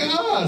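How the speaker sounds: high-pitched "ah!"; brief high exclamation, put on; mock wail. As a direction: let out a short, high mock cry. Mock reaction to a tearful movie.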